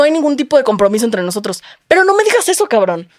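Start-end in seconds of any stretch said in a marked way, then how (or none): 1.9-3.1 exasperated. Retold or imitated. Retelling exasperated reaction to date.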